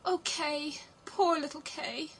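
high, plaintive lament; sighing. A narrator's reading. Gerda grieving for little Kay, sighing. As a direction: sigh it out in a high, sorrowful voice. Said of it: "sighed Gerda".

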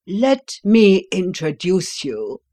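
loud, slow announcement; loud slow unveiling; shouted. A narrator's reading. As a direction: call it out grandly. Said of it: "he shouted".

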